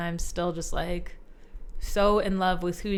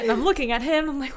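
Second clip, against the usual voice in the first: ordinary talk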